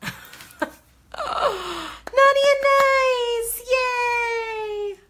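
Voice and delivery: very high, loud, celebratory squeal; gleeful. Real, not acted. Crafter cheering the revealed sign, gleeful squeal. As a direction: squeal it out with glee.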